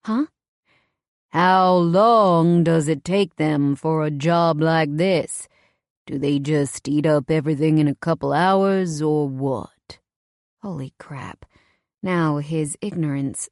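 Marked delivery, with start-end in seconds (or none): 1.3-10.0 cold questioning. A narrator's reading. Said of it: "he asks coldly".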